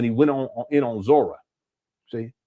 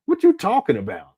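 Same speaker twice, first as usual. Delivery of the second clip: mock bafflement; 3 dB louder than usual. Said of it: "I said".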